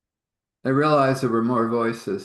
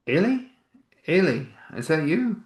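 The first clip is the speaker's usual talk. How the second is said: surprised call; 8 semitones higher than usual. Acted. A voice suddenly calls out.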